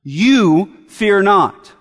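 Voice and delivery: "You" drawn out on its own, then "fear not"; stress on You. Shown as a demonstration. Preacher singling out each listener, stress on "You". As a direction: set "You" apart, slowly, as a personal address. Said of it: "talking to them as individuals".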